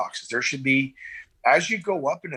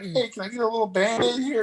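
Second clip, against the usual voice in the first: mock injured patient; 9 semitones higher than usual. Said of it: "And he's like".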